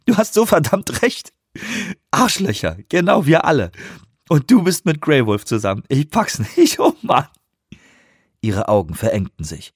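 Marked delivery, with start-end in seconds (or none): 0.0-7.3 viel höher, lachend herausgepresst; hysterisch lachend. A narrator's reading. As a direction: lacht sich kaputt beim Reden. Anawak im Lachanfall. (Lachanfall, hoch und laut)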